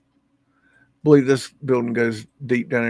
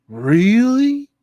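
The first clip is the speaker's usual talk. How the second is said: mock surprise; 8 semitones higher, 6 dB louder than usual. Skeptic hears about fifth element.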